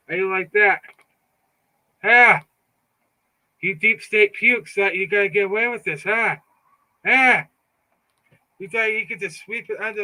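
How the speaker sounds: loud jeering huh; taunting. Real, not acted. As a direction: jeer at them, daring a reply. Taunting the deep state.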